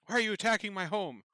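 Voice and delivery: high, fast anxious demand; indignant but scared; frightened noble. Acted.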